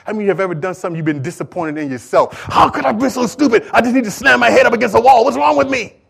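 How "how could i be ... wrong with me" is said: higher, exasperated self-scolding; self-reproach. Acted. Preacher voicing Peter's regret at himself, exasperated self-reproach. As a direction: berate yourself in rising frustration. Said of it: "I'm sure he was thinking".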